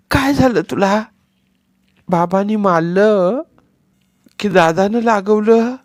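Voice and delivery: put-on high, lisping little boy asking worried; childish lisp, anxious and loud; worried toddler voice; small child.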